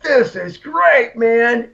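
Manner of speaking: drawn-out stoned wonder; amazed delight. Retold or imitated. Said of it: "I was like".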